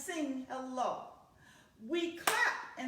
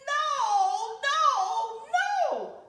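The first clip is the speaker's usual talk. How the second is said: rising no, no, no, the last much higher and louder; scolding mom shout; 9 semitones higher, 8 dB louder, much slower than usual. Acted. Mother yelling at David over the overflowing tub, shouted. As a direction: yell it like a fed-up mother.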